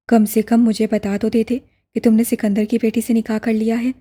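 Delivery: usual speaking voice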